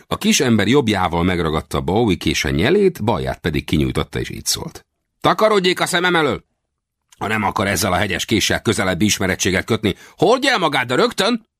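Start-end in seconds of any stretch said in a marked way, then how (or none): 5.2-11.5 high, indignant order to clear off; threatening. A narrator's reading. Little man with a knife ordering the stranger away, high and threatening. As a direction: menace him in a high, worked-up character voice. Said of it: "Fenyegetőn közeledett hozzám".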